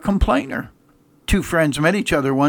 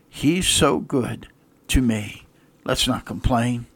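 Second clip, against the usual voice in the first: tender praise; 6 semitones lower, 4 dB quieter, slower than usual. Real, not acted. Soft slow close of praise.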